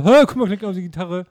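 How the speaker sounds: excited demo. Acted. Showing friends the guitar app, mock excited. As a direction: show off a silly app with mock amazement. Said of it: "dann so".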